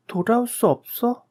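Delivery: put-on very high, startled little-girl question; a frightened child echoing back; child's voice. Dream, child asks parents. (아주 높은 어린아이의 놀란 목소리)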